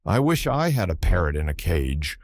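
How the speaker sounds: ordinary delivery, nothing marked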